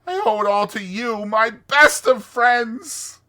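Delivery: high, loud, swooning Toad; theatrical, soaring rapture; rapturous Toad. Acted. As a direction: gush ecstatically, savoring each marvel.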